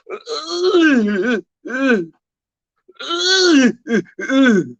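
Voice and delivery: high hammed-up moaning; a high, overdone fake moan; mock moan. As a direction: imitate the moaning lady for laughs. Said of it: "the lady kept going".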